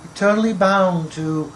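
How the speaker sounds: measured recital, phrase by phrase with long pauses; poetry reading. Acted. Poet reciting to an audience about skulls of a lost tribe, measured poetry reading. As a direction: recite it gravely, weighting single words and letting lines fall. Reads second poem aloud.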